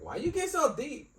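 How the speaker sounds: high-pitched imitation of a puzzled wife; retold wife's question. Wife questions husband about his voice, high mimicking. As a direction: jump up high to mimic her asking.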